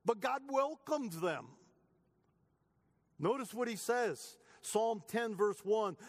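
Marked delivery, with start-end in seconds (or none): none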